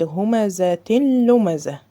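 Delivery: همزة لمزة slow, tanween swallowed into the lam; slow tajweed chanting; correct recitation. Shown as a demonstration. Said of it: "قلت".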